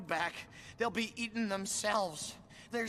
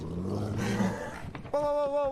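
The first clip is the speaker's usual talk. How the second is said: alarmed whoa; 8 semitones lower, 4 dB louder, much slower than usual. Acted. Rider losing control of the dragon, crying out. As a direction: try to stop something getting away.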